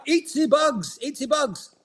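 high, hammed-up Schwab impersonation; Klaus Schwab. Co-host entertaining his host with a Klaus Schwab impersonation, high and hammed-up. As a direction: mimic him in a silly high-pitched voice. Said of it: "I could do my Klaus Schwab impersonation".

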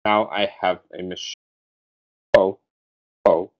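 put-on robotic German accent; stiff, flat German villain accent; Alan Rickman. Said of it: "[name]'s German accent. It sounds like a robot".

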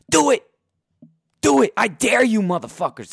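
high, loud taunting shout; shouted dare. Real, not acted. Daring the Giants to hire Garrett, loud high taunt. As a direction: yell the challenge right at them.

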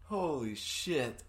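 slow, stoned awe; high and amazed; stoner. Real, not acted. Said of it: "I'm so high right now".

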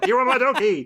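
high-pitched mock battle cry; character's yell. Shown as a demonstration. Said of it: "that one man who yells out".

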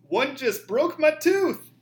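loud, high, slow mock outrage; outraged review. Reading a one-star review aloud as the outraged customer, loud and slow. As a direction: shout it like an angry customer.

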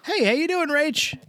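"Hey, how you doing, Rach" high and bright; cheerful lilting "Hey, how you doing, Rach"; friendly greeting. Shown as a demonstration.

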